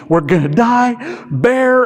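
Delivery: high-pitched, slow mock panic. Limbic system panicking.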